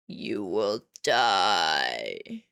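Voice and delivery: very slow, drawn-out ominous voice; menacing dark entity. Acted. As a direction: stretch every word like a looming doom god.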